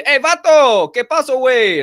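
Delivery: loud, high Mexican Spanish greeting; Mexican Spanish accent. Retold or imitated. Restaurant coworkers greeting each other, loud Mexican Spanish accent. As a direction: bark it like a coworker on the line. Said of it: "they're just speaking".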